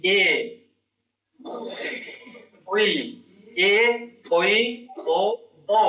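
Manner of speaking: এ, ওই, ও each alone with pauses; teacher sounding এ, ওই, ও; vowel recitation. Shown as a demonstration. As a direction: sound out এ, ওই, ও for the pupil. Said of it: "এর কি".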